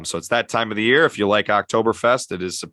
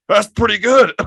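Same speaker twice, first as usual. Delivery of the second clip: high, loud mock voice of the father-in-law; 13 semitones higher, 7 dB louder than usual. Host mimics father-in-law, high and hammed-up. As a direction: play the unimpressed father-in-law after downing the whiskey. Father-in-law's reply retold.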